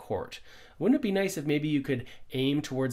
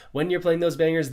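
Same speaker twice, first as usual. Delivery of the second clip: usual speaking voice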